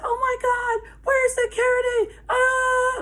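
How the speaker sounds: high-pitched imitation of a panicking girl; hammed-up panicked squeal; mock freak-out. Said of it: "freaking the fuck out".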